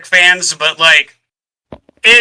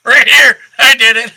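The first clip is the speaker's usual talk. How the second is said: higher, louder smug alien voice; Nessus gloating; 6 semitones higher, 4 dB louder than usual. Retold or imitated. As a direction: proudly own the crime, gleeful. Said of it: "and Nessus is like".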